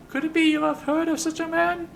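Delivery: hopeful high falsetto question; Ben the Sender. Acted. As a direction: ask hopefully in a piping high voice. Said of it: "looks at you hopefully again".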